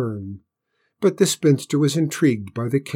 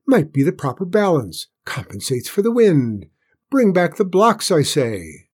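higher, louder eager rambling; excited; 6 semitones higher, 4 dB louder than usual. A narrator's reading. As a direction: rush on, thrilled by the idea. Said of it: "he cried".

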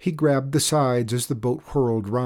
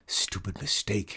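hissing; 6 dB quieter than usual. A narrator's reading. Chester cursing his own steering error, hissing. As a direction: hiss it softly through the teeth. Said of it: "hissed Chester".

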